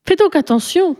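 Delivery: put-on impatient girl scolding her cousin. A narrator's reading. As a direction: tell him off, annoyed. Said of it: "d'un air impatienté".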